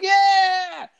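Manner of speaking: high, loud group "Yeah!"; kids' cheer. Retold or imitated. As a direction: yell it like excited little kids.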